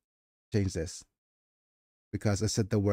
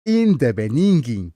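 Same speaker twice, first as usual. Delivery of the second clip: lightly hammed-up Nigerian accent, second take higher and slow; mock Nigerian accent; 6 semitones higher, 7 dB louder than usual. Streamer joking at her own misread opening, mock Nigerian accent. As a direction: tease the line in a Nigerian accent. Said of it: "I'm reading this in the Nigerian accent".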